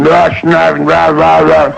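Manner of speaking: chomping, grunting rah sounds; mumbled eating noises. Retold or imitated. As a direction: imitate men talking while eating. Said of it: "They're talking and eating".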